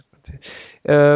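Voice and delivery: whispered aside. Real, not acted. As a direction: breathe it to yourself.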